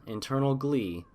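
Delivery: plain reading voice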